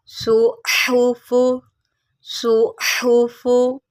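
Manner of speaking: सुहूफू slowly, h drawn from the throat; correct throaty h. Shown as a demonstration. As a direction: model सुहूफू slowly with a whistled s and strong throaty h. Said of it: "ये हलक से निकाली जाएगी".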